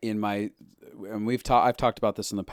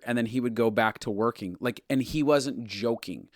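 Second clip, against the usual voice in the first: ordinary delivery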